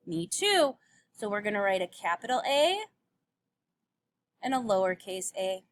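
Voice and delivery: ordinary talk, nothing marked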